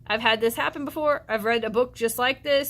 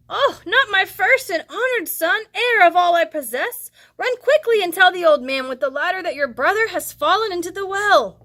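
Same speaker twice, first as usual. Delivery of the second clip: higher, louder urgent mother; anxious mother giving orders; alarmed mother; 7 semitones higher, 6 dB louder than usual. Acted. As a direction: send the boy running for help.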